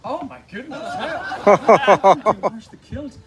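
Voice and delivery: real laughing, high-pitched echo of the question; laughing disbelief. Real, not acted. Guest asked about washing the kilt, laughing in disbelief. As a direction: repeat it slowly through helpless laughter.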